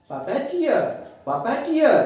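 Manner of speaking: Puppeteer with टियर stressed, then falling; stress on last syllable. Shown as a demonstration. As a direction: run into a strong टियर in puppeteer. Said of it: "टीयर को क्या करेंगे हम". (Puppeteer में टियर पर ज़ोर)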